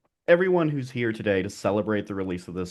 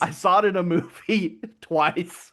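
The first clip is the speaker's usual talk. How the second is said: laughing; 6 semitones higher, slower than usual. Real, not acted. Mocking friend's claim, laughing.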